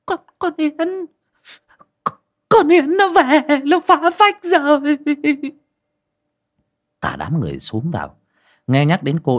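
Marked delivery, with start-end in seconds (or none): 0.1-5.0 very high, stammering, slow and shaking with fright; terrified. Acted. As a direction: blurt it out in horror, voice way up. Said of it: "kinh hãi".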